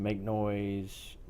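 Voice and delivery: ordinary talk, nothing marked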